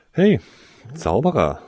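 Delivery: lightly aping the sneer back; mocking echo. Tarsoin aping the Slytherin boy, mild mock echo. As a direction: parrot the insult with a smirk. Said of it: "äffte Tarsoin ihn nach".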